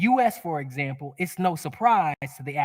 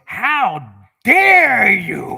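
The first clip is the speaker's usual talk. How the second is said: slow, drawn-out, higher and louder outrage; indignant how dare you; 6 semitones higher, 10 dB louder, much slower than usual. Retold or imitated. Father's ego thinking at his son, slow indignant outrage. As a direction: stretch it out like an offended father. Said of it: "My thinking was".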